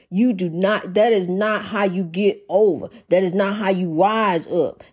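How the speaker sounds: normal talking voice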